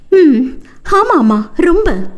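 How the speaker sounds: a cheeky, sulky "yes, very"; sassy pout. Acted. Wife pouting back at her husband, sassy and high. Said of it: "என்று முறுக்கி கொண்டு சொல்ல".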